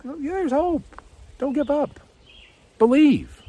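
Jesus brushing off despair with hope; cheerful Jesus. Acted. Jesus reassures the hopeless.

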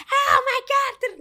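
high, slow squealing party voice; mock party squeal. Mock loud party talk.

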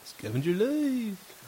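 slow announcer read of Scavenger Life; podcast intro voice. Shown as a demonstration. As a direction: draw out Scavenger Life like a radio host. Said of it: "Like, like that".

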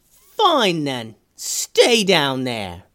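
high sulky sprite, slow parting shot; pouting sprite calling down the hole. Acted. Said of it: "The boys heard the sprite yell".

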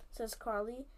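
ordinary delivery

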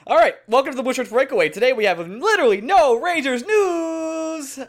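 podcast host reopening the show; host hype. Acted. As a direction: kick off the segment with energy. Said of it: "restarting the show segment".

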